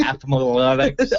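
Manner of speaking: athletics slowly mangled into ath-uh-mo-letics; garbled athletics. Pretend. Co-host tops the joke, athletics as ath-a-mo-letics. Joking about extra syllables in words.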